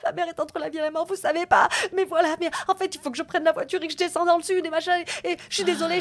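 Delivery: high, loud, tearful rush; reliving sobbing. Retold or imitated. Collapsed on the floor telling her colleague, sobbing. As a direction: blurt it out through sobs, fast. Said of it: "je commence à pleurer".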